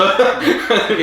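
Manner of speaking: high-pitched giving in, half laughing; hammed-up surrender; mock pleading. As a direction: beg comically for it to stop. Acts out begging under pressure.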